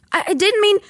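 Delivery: put-on stammering boy. Harry stammering.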